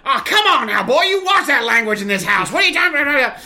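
father scolding son over dinner-table cursing; scolding dad. Retold or imitated. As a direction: scold the boy about his language. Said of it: "my dad just goes".

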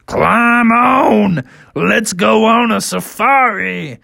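high, slow creature voice; drawn-out hearty invitation; welcoming triceratops. Acted. Triceratops inviting bears on safari, hearty.

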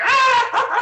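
loud, high-pitched mock scream; mock crowd scream. Joker acts out everyone's shock with a high mock scream. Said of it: "everyone's like".

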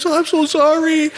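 high, slow wailing apology; mock weeping apology. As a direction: cry it out like the sobbing men. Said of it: "they're saying".

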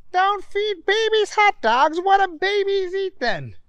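laughing disbelief. Acted. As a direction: giggle through the question. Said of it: "started laughing even more".

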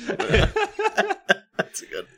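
squealing laugh through words. Real, not acted. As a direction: crack up as you say it. Laughing at the joke.

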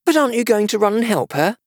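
high-pitched, astonished question; surprised. A narrator's reading. Alice questioning the unconcerned King, surprised. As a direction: ask in disbelief, pitched well up. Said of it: "very much surprised at his taking it so quietly".

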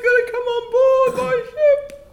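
far away. Acted. Captain calls from far.

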